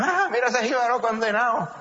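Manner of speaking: high-pitched mock exclamation; mocking jeer. Townsfolk spot a dressed-up jíbaro, jeering. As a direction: jeer at the country boy putting on airs. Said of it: "Pues le decían".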